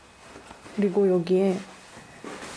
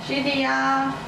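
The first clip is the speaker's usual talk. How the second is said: higher, careful voice for Siri; talking to voice assistant; 4 semitones higher, slower than usual. Real, not acted. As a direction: speak clearly and slowly to the phone. Speaking to Siri.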